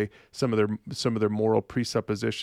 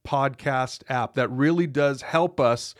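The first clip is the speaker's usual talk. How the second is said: upbeat host sign-off read, higher pitch; promo read; 4 semitones higher than usual. Acted. Host asks listeners to rate and share, upbeat promo read.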